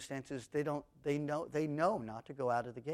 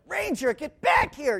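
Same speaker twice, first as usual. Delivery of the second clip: loud, higher shout of "Ranger, get back here!"; calling the dog back, much louder and higher; yelling at the dog; 12 semitones higher, 11 dB louder than usual. Retold or imitated. Late at night, yelling for the dog Ranger: a loud, high call. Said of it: "And I'm yelling".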